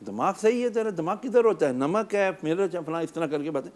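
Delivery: high-pitched acted scolding; mock angry tirade, voice pitched well up; needless anger. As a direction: play a husband berating his wife over dinner. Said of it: "لیکن اگر خام خواہ غصہ رہے".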